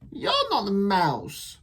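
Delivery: high, slow letdown; disappointed child. Acted. Child meeting the wrong creature, high and slow. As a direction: sigh it out.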